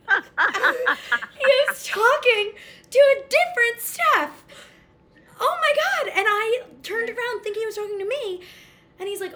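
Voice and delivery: high, loud laugh-crying through the words; embarrassed laughter. Real, not acted. Admitting the mix-up to friends, laughing high and loud. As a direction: laugh so hard it nearly cracks.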